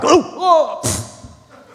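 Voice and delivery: high-pitched mock groans, then a puff; hammed-up "oh, oh" of someone shot; mock movie death. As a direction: act out a tidy movie shooting. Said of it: "not like you see in the movies".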